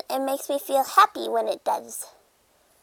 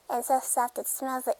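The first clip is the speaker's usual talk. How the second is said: ordinary talk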